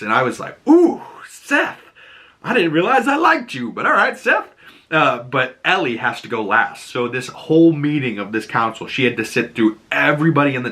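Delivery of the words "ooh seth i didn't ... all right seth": much higher, cooing and impressed; a high, swooning "ooh" of surprise. Retold or imitated. Viewer reacting to Seth's speech, high impressed coo.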